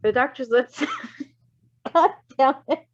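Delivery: ordinary delivery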